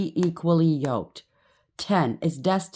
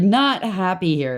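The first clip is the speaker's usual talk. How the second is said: usual speaking voice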